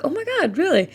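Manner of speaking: surprised reply to her uncle's encouragement; mock surprised delight. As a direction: act surprised and thrilled. Her reaction to uncle's support.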